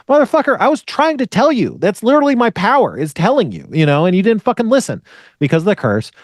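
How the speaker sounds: high-pitched mock indignation, easing slightly; exasperated comeback, pitched well up; exasperated Cassandra. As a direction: act out a fed-up prophet protesting. Voices exasperated Cassandra answering back.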